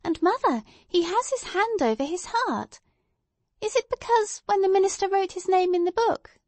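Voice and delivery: put-on high, eager little-girl voice; high-pitched, insistent small girl; inquisitive child. A narrator's reading. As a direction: press mother with a child's questions. Narrator voices child Pearl.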